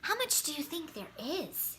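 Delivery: ordinary delivery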